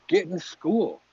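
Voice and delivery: usual speaking voice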